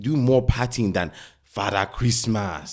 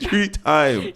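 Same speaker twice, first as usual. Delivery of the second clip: high playful shout of three times; high mock shout; 10 semitones higher than usual. Shown as a demonstration. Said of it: "How many times did I say it".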